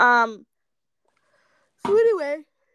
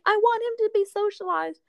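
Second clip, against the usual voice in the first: dog owner voice; 10 semitones higher than usual. Retold or imitated. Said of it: "she was like".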